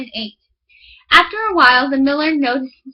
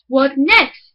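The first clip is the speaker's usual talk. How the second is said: louder, eager question; 5 dB louder than usual. Acted. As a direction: push for more. Miller eager.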